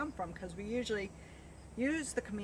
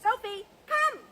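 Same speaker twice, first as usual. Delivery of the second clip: calling the dog; 13 semitones higher, 9 dB louder than usual. Real, not acted. Dog training recall call.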